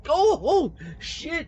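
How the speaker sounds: high, loud startled gasp of realization; sudden high "oh, oh, shit" as it dawns; caught-out "oh shit". Retold or imitated. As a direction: yelp it like you just got caught. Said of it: "I'm like".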